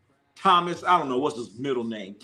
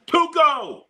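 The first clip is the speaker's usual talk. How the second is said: high, loud hail on the name; calling out; 12 semitones higher, 4 dB louder than usual. Real, not acted. Host greeting Tuco in the house, calling out. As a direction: holler the name in greeting, pitched way up. Hailing someone arriving in the house.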